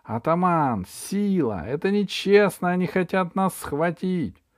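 high-pitched indignant appeal; crying out. A narrator's reading. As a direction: call for help as a wronged girl, pitched high. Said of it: "Алиса закричала". (возмущённый высокий крик о помощи)

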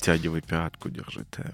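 soft breathy purr, almost whispered; breathy vintage purr. Shown as a demonstration. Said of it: "давай репетировать".

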